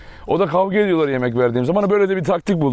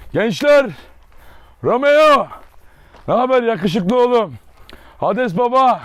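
calling out to the dogs; 5 semitones higher, slower than usual. Real, not acted. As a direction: sing out their names to get their attention.

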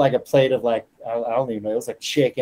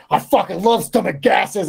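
spiteful taunt; 7 semitones higher, 3 dB louder than usual. Retold or imitated. Spiteful taunt at teammate while eating.

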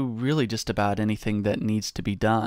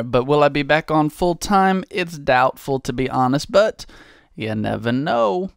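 ordinary delivery

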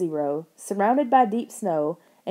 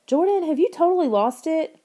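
much higher, loud disbelieving screech; 9 semitones higher, 3 dB louder than usual. A narrator's reading. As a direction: shriek at a brother gone mad. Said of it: "Lauren screeched".

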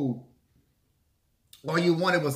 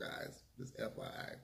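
whispered aside; 21 dB quieter than usual. Real, not acted. Aside to viewers.

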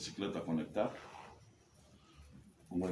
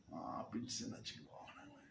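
whisper; 5 semitones lower, 15 dB quieter than usual. Real, not acted. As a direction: breathe it out in a whisper. Recuerdo tierno de Acapulco. (susurro lento y suave)

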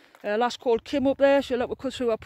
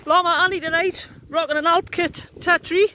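mock showbiz announcer; 5 semitones higher, 7 dB louder than usual. Showing off tent, showbiz announcer.

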